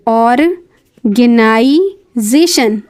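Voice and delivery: Organisation drawn out syllable by syllable, very slow; organisation spoken slowly and clearly, ऑर-गे-ना-इ-जे-शन; slow pronunciation demo. Shown as a demonstration. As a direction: stretch every syllable of organisation for learners. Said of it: "यहाँ pronunciation इस तरह लिखा हुआ है".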